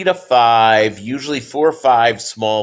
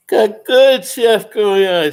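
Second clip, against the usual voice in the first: yawning; 10 semitones higher, 5 dB louder, slower than usual. Real, not acted. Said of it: "Sorry for yawning".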